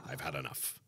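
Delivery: very low, soft, resigned aside; deflated mutter. Pretend. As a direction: drop right down and mumble it to yourself. Same words again as deflated mutter.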